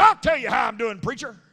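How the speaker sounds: retold outburst at the preacher; imitated angry yell. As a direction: mimic the patient yelling back. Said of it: "he yelled at me".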